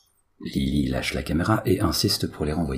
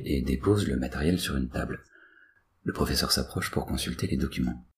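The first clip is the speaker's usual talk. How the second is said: ordinary delivery, nothing marked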